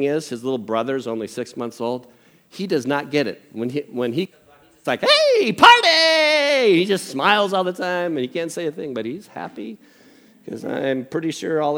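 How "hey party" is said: very high, loud party whoop; exuberant baby. Retold or imitated. Little brother who does not get it, hammed-up happy cheer. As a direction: squeal with over-the-top glee.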